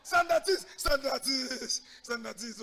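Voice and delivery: high, loud mock drill call; shouted attention. Soldiers answering roll call, shouting then fading.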